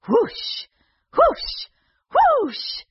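rising breathy whooshes; magic whoosh. Acted. Magic sound effect rising.

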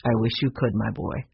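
low, sorrowful grandfather; low, downhearted old man; sad. A narrator's reading. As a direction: answer the child sadly, voice sinking. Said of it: "said Mister Alden sadly".

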